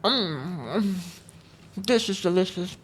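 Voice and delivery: talking while eating; mouth full. Real, not acted. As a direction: talk through a mouthful of burger. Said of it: "I'm still eating my burger".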